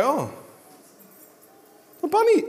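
put-on delighted baby voice. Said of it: "And then they'll go".

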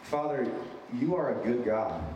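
ordinary talk

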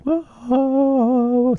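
very high, sustained eerie wail. Retold or imitated. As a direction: croon it like a ghostly theme tune. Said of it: "Don't you love my renditions?".

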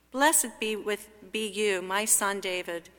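ordinary talk, nothing marked

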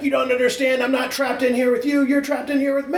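high-pitched comic-book vigilante quote; Rorschach. Acted. Quoting a Watchmen line to fellow fans, high-pitched impression. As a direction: Watchmen line acted out, pitch pushed well up. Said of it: "where Rorschach's like".

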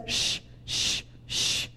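soft, whispered shushes; spaced, quiet hushes to settle everything; hushing. Acted. Jesus calms the storm, soft shushing. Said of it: "And then he just says".

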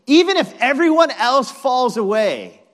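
loud, high-pitched boast; hammed-up cocky vow; bravado. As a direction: declare it with big swaggering bravado. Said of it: "don't you love this bravado".

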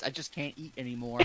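soft, low groan of a full belly; stuffed and defeated, soft. Acted. Roscoe to the table, too full to eat, soft and low. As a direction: murmur it like you've eaten too much.

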